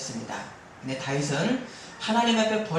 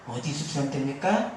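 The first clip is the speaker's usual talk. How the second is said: ordinary delivery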